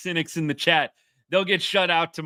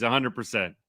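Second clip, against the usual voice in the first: ordinary talk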